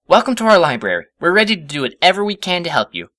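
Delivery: high, bright welcome; overly keen host; eager Twilight. A narrator's reading. Twilight welcomes the professor, high and eager.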